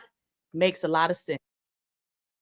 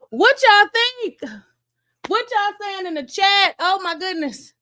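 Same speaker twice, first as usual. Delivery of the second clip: high-pitched excited call to viewers; worked-up, squealing reaction to big news; excited exclaiming; 9 semitones higher, 5 dB louder than usual. Real, not acted. Excitedly asks viewers about verdict.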